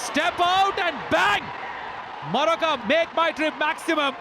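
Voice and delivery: high, drawn-out six call; excited cricket commentary. Real, not acted. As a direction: sell the six like a showman. Six called live. (ఉత్సాహంగా six వ్యాఖ్యానం)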